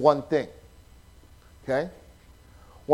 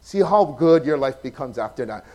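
ordinary talk

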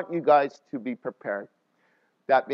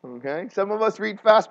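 ordinary talk, nothing marked

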